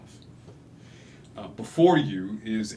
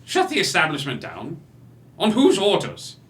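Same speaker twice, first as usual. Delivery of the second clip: a tiny man outraged at the threat; indignant challenge; 4 semitones higher than usual. Acted. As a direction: puff up and demand answers. Small man challenges robed man.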